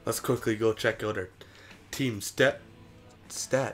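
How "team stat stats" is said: talking with hiccups; hiccuping between words. Real, not acted. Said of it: "Got the hiccups".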